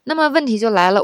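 normal talking voice

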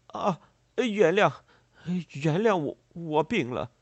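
high, loud, drawn-out apology; pleading farmer. A narrator's reading. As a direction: beg pardon in agitation. Confused farmer begs forgiveness. (农人激动地恳求原谅)

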